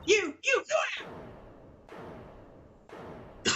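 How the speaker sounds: high put-on girly witness voice; shrill April May voice. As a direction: snap at everyone like a spoiled starlet. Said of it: "Killing me doing this voice".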